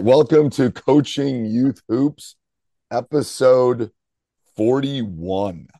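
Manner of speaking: loud, high, drawn-out episode announcement; show announcer. Real, not acted. Host opening the youth hoops podcast, announcer style. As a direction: announce it big and slow, pausing between phrases.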